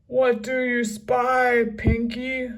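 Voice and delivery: loud gruff call up; captain's shout. A narrator's reading. Captain calling up to Pinky, loud gruff shout. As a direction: holler up the tree. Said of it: "shouted Captain Bones".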